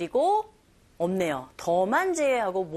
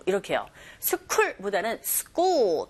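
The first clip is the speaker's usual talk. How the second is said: ordinary talk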